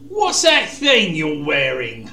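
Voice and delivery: slow, disdainful knight voice; looking down at him; mocking question. A narrator's reading. Kay mocks Keith's armor.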